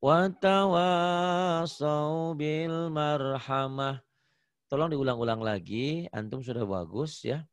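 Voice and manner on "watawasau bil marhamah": slow chant, "Watawasau" pitched higher; slow model of "Watawasau bil marhamah"; model recitation. Shown as a demonstration. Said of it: "Jangan watawa ya".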